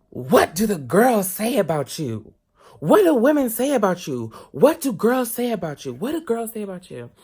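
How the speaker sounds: high, loud mocking voice of a prying older woman; scandalized nosy lady. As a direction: grill him like a scandalized auntie. Lady prying about girls.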